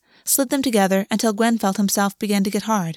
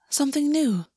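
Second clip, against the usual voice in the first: lightly said, pitched up; 6 semitones higher than usual. A narrator's reading. August answers Gwen on the bed, light voice. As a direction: keep it light and high. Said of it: "His voice was light".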